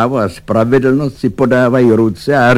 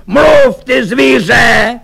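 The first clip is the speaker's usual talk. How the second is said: furious high-pitched roar at a cornered servant; bellowing; 14 semitones higher, 5 dB louder, slower than usual. A narrator's reading. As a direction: roar it loud and very high, demanding an answer. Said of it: "řval na něho".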